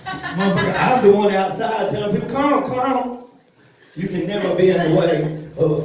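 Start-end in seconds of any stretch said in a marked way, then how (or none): 2.3-3.2 higher beckoning call, far up; pitched-up "come on" to the crowd; mock usher. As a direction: wave people out eagerly. He waves people out.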